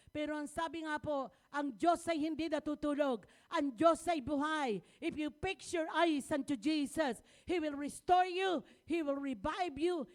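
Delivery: loud, driving sermon cadence with shouted peaks; full-force preaching. Acted. Pastor rousing the congregation about God's power, loud preaching. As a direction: preach it out to the back row.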